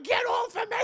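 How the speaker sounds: very high panicked cry; mock frantic protest. [name] shoves John away, high mock panic. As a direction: shriek it in hammed-up distress.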